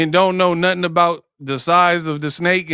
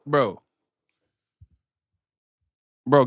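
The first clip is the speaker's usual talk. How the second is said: normal talking voice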